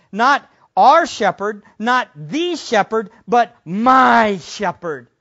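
"our" "the" "my" each hit loud and slow; stress on our, the, my. Shown as a demonstration. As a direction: lean hard on our, then my. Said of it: "there's this intimacy".

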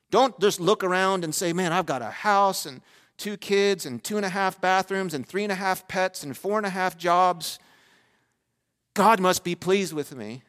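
loud, high, emphatic sermon delivery; full-force preaching. Real, not acted. Preacher warning the church about false assurance, full-force preaching. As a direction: drive each warning home from the pulpit.

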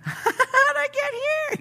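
very high, slow mock lament; bewildered wail. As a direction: squeal it slowly in falsetto. Person far from God, bewildered.

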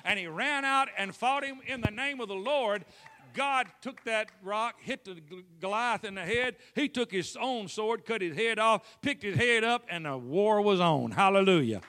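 fiery preacher building to a shout; preaching at full force. Acted. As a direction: drive the story home to the crowd. Preaching climax, rising volume.

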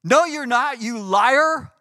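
loud, high rebuke of the demon; indignant retort. Retold or imitated. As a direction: snap back at a lying demon. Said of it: "It was just like this".

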